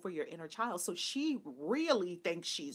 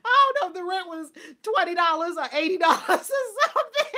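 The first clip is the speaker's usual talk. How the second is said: loud, high words broken by laughter; laughing hard; 10 semitones higher, 10 dB louder than usual. Real, not acted. Speaker guessing mom's rent, cracking up. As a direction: talk right through the giggles.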